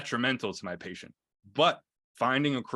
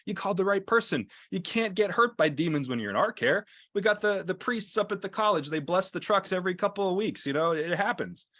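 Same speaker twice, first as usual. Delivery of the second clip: higher-pitched, emphatic retold sales pitch; confident reassurance; 7 semitones higher than usual. Medic talking a patient out of demon fears, acted confident reassurance. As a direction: convince him he is completely safe with you.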